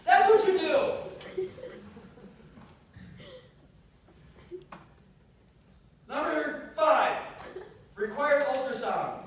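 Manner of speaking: loud, high, drawn-out rallying cry; impassioned high-pitched exhortation; full-force preaching. Real, not acted. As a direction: shout it to rouse the whole crowd. Shouted exhortation and point.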